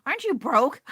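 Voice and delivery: high, then a loud go home; telling shoppers off in her head, then a loud outburst; mock exasperated shout. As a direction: shoo them off loudly. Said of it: "I was like".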